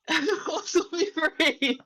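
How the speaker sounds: high, slow mock battle cry through laughter; high, laughing mock cheer; laughing echo. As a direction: giggle out an imitation rallying cry. Laughingly echoes the rallying cry.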